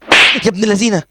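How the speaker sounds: high, loud shocked curse; startled curse. Real, not acted. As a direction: caught off guard, blurt it. Surprised by enemy in game. (مفاجأة وشتيمة عالية)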